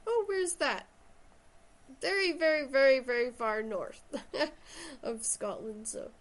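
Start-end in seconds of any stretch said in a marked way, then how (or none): 0.1-0.9 puzzled questioner. Retold or imitated. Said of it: "Everyone's always like". 2.0-5.0 laughing. Real, not acted. Laughs at remoteness.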